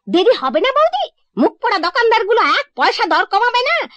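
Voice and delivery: a shrill-pitched housemaid bawling about shopkeepers; maid shouting. Retold or imitated. Maid shouts to the sister-in-law about greedy shopkeepers, loud and high. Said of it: "সে এসেই চিৎকার শুরু করলো".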